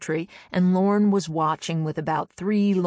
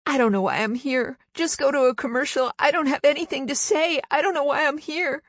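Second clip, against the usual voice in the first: higher, flustered, scattered actress voice; Terri Garr; 9 semitones higher than usual. Shown as a demonstration. On Carson, doing her for Johnny to guess, higher flustered voice. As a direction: dither like a nervous guest who wants the show to cut away. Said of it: "Terry Garr".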